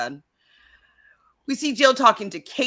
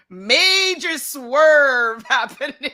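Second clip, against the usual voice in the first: amused; 5 semitones higher, 6 dB louder, much slower than usual. Retold or imitated. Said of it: "And then I was like".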